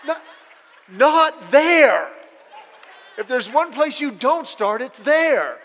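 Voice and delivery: hammed-up, high, loud protest; exasperated outburst. Son refusing mom's idea of dating relatives, loud and exasperated. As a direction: burst out in comic indignation.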